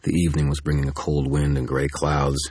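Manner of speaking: usual speaking voice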